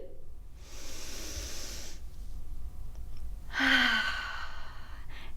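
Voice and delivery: long, soft breathy sigh letting tension go; big sigh out. Shown as a demonstration. Teacher showing kids how to let anger out, long sigh. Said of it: "And one more time".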